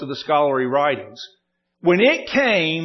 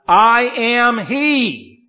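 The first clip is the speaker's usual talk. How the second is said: "I am he" stretched very slow and higher; solemn declaration; 7 semitones higher, 5 dB louder, much slower than usual. Acted. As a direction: proclaim each word of "I am he" deliberately. Reading Jesus's declaration aloud.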